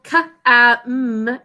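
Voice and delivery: ka-am stretched into its sounds; first syllable sounded out. Shown as a demonstration. Teacher breaking down first syllable, sounding out ka-am. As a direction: draw out ka-am slowly. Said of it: "The first syllable".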